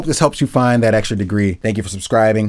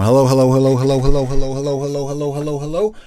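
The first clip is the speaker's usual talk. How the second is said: circling the mic; 3 semitones higher than usual. Shown as a demonstration. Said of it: "if I'm going around the microphone".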